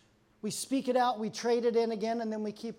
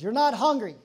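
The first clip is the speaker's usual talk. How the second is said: louder, emphatic parent shutting it down; firm parental scolding; 8 dB louder than usual. Acted. Parent telling kids to go to sleep, firm scolding.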